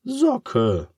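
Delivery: the pig repeats the word, exasperated; repeating pig. Acted. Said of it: "wiederholt Charles".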